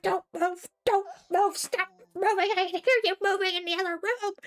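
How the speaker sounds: high, pressing command, speeding up at the end; urgent hissed warning. Retold or imitated. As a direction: beg her to freeze, fast and tense. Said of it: "and I'm like".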